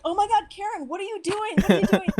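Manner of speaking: high, loud retold outburst of disbelief; shocked. Shocked dinner host rebuking Karen, high mock-shocked outburst. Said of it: "she was so shocked".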